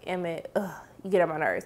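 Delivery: exasperated grumble. Real, not acted. Vlogger fed up with Emmett, low soft grumble. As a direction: sigh it out, fed up.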